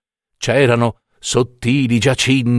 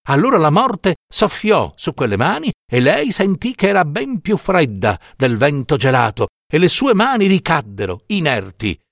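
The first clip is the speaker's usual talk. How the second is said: plain reading voice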